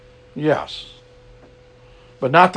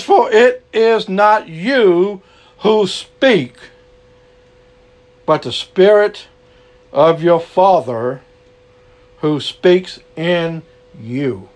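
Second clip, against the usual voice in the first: slow solemn scripture read; slower than usual. Shown as a demonstration. Preacher reading Matthew aloud, slow and solemn. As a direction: read the verse slowly, weighing each word. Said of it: "And it says".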